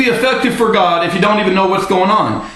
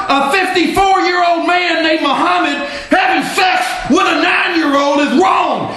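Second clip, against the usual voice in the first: raised, heated shouting tirade; angry rant; 5 semitones higher than usual. Real, not acted. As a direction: hammer every point like you're fed up. Angry rant against opponents.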